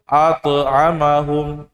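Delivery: at'amahum said slowly with the wrong thin bounce; wrong qalqalah. Shown as a demonstration. Teacher shows students the wrong reading, slow deliberate mistake. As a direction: stretch at'amahum out as the mistake to avoid. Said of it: "nggak boleh".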